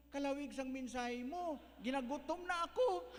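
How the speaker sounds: put-on high, loud childish complaint; whining child. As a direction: whine like a hungry kid stuck in a long service. Child complains sermon too long.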